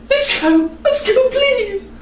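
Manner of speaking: high, slow pleading; begging. Acted. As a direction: beg your sister to leave. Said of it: "she begged".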